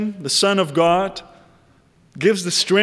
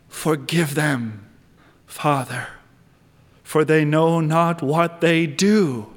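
hushed repeat; 4 semitones lower, slower than usual. Shown as a demonstration. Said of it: "repeat of the quote".

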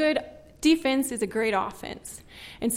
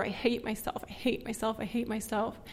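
muttered self-hate; 10 dB quieter than usual. Retold or imitated. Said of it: "saying over and over".

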